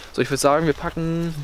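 ordinary talk

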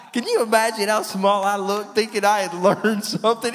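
high, loud self-mocking laughter through the words; laughing at himself. Real, not acted. Preacher laughing at his own pride before the church, laughing. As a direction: laugh at your own foolishness as you say it.

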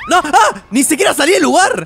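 high, loud laughing shock; scared laughing yelp. Real, not acted. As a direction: laugh through the fright. (grito asustado entre risas)

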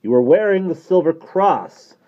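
loud, slow, high pronouncement; solemn priest. A narrator's reading. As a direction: declare it gravely, drawing it out. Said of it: "said Father Higgins solemnly".

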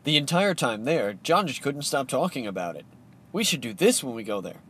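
usual speaking voice

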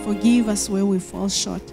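normal talking voice

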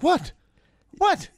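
very high, loud squawked question; mock outraged "what". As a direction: imitate someone instantly riled, voice shooting up. Acting out an easily riled person.

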